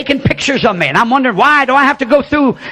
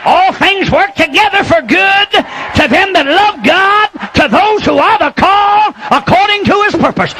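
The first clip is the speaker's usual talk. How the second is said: preaching at full force; 7 semitones higher than usual. Acted. Preacher shouting to the congregation about revival. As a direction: lift the crowd with fiery preaching.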